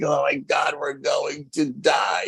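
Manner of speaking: high, drawn-out mock wail of doom; mock hysterical fear. Surrounded kids think they will be killed, hammed-up terror. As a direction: imitate kids convinced they are doomed. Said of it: "about to cry hysterical".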